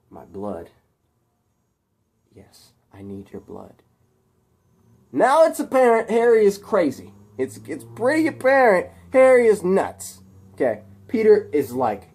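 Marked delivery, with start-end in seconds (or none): 0.1-0.7 soft, low echo; hushed disbelief. Acted. Peter taken aback by Harry's demand, hushed disbelief. 2.3-3.8 very soft, low confirmation; hushed menace. Acted. Harry confirming his demand to Peter, hushed menace. 5.1-10.2 high, loud storyteller's outburst; emphatic exclamation. Real, not acted. Storyteller telling listeners Harry is nuts, emphatic exclamation. Teller exclaims at villain's madness.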